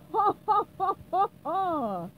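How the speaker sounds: loud gloating wizard cackle; villain laugh. Acted. Evil wizard celebrating in his castle, loud gloating laugh. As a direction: cackle over your coming victory.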